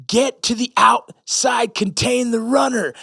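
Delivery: high, drawn-out imitated command; barking at a soldier. Drill sergeant ordering a soldier, imitated command. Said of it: "how I would talk to a soldier".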